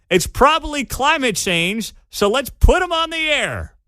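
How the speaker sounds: high, mock-incredulous tirade; sarcastic rant. Host mocking rival station putting health director on air, sarcastic rant. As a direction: pitch it high with hammed-up sarcasm.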